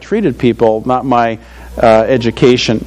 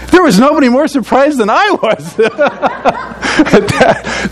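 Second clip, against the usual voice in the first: laughing through the words, pitched up; 11 semitones higher, slower than usual. Real, not acted. Grandfather tells the congregation about his grandson, laughing. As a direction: chuckle out the surprise as you tell it.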